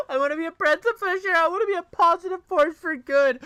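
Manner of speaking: high, loud, laughing parody of her words; mocking imitation. Mimicking the streamer's words with a high laughing sneer. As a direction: parrot her line back through laughter, sneering.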